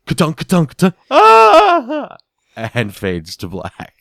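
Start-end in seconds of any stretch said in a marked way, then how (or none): 0.1-0.9 imitated rivet gun. 1.1-2.2 mock scream. Scream after rivet gun fires.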